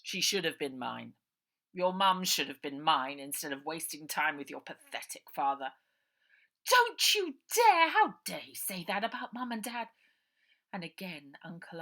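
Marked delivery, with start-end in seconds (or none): 0.0-5.7 menacing villain uncle. Acted. Villain Uncle Arthur taunts Ida. 6.7-9.9 outraged shout, much higher and louder; furious girl. Acted. Ida furious at uncle.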